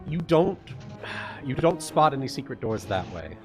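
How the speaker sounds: normal talking voice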